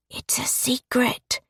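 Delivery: put-on high, soft child's whisper; a small girl whispering a secret; little girl whisper. A narrator's reading. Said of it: "whispered the little girl".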